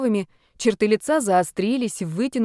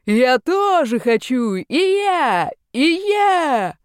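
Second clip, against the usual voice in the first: much higher, louder wild wailing; wailing yell; 7 semitones higher, 5 dB louder, much slower than usual. Acted. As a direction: howl it like a madman. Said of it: "вопил он". (безумный высокий вопль)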